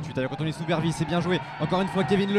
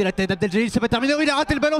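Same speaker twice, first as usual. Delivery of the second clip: excited sports commentary; 7 semitones higher, 9 dB louder, faster than usual. Real, not acted. Live goal-mouth play-by-play.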